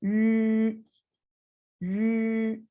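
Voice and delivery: teacher modelling यू with the y sound; Chinese yu. Shown as a demonstration. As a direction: say यू with a clear y glide. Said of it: "कैसे बनेगा?".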